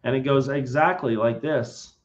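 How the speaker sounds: usual speaking voice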